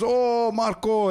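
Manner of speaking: high, slow delighted mock Cypriot cop; pleased recognition. Policeman recognizing his cousin Marco in a Cypriot accent. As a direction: greet a relative warmly.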